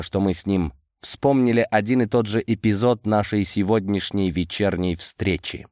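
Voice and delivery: plain reading voice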